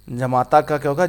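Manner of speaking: ordinary delivery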